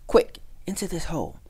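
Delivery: high, alarmed command; urgent escape order; urgent hurrying. A narrator's reading. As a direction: hurry a friend to safety, pitched high. Town mouse urging escape from danger.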